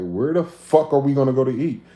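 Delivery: annoyed at the endless wait; frustrated shout. Retold or imitated. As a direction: throw up your hands and yell. Frustrated printer queue.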